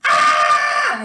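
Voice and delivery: very high, loud imitated shriek; retold scream. Patient screaming as the stent comes out, imitated shriek. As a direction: scream as if it really hurt. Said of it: "I was still screaming after".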